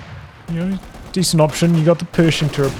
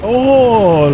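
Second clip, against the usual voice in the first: surprised exclamation; 8 semitones higher, 6 dB louder than usual. Real, not acted. Lucky escape in the match.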